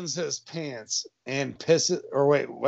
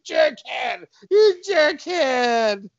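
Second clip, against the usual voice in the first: high, drawn-out crybaby cop; hammed-up tearful wail; mock loud crying; 10 semitones higher, slower than usual. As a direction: bawl the insult. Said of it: "Cop lays on the ground and cries loudly".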